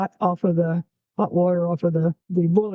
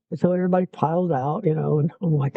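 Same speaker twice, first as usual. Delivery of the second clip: ordinary delivery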